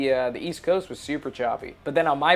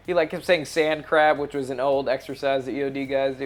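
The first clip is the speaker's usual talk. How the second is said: ordinary talk, nothing marked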